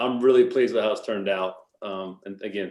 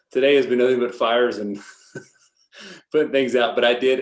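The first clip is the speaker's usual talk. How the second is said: laughing through the words, a little louder; 2 semitones higher, 7 dB louder than usual. Real, not acted. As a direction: chuckle while admitting a hectic day. Apologizing, laughing about busy day.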